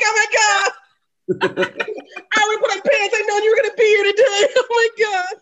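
high, loud pretend fluster; mock starstruck surprise. Podcaster imagines finding the comedian at home, loudly flustered. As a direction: gush in playful shock at a surprise guest. Acts reacting to surprise celebrity visitor.

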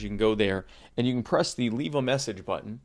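normal talking voice

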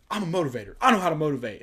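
mock Jason Brown. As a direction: lightly imitate a self-proclaimed motivator. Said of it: "That one where he's like".

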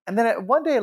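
ordinary talk

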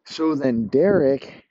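usual speaking voice

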